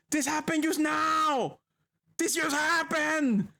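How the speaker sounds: high-pitched, incredulous ranting; exasperated yelling. Real, not acted. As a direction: shout your disbelief at the game, drawing out the last words. Streamer protests a needless flashback.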